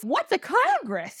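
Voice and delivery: high-pitched mock confusion; retold puzzlement with a squeak; comic bewilderment. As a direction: ask it high, as if totally lost. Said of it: "He doesn't know what that is either".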